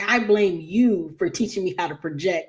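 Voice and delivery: ordinary delivery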